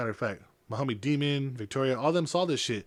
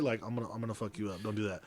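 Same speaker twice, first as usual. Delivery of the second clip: quick, low, soft threat; understated threat, kept down low; low-key warning; 4 semitones lower, 9 dB quieter, faster than usual. Retold or imitated. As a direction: warn him off quietly and fast. Warning a man who got handsy.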